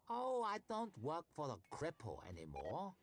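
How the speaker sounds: questions rising in mock innocence; mock-hurt taunting. A narrator's reading. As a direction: needle her with fake hurt. Vitari mocking.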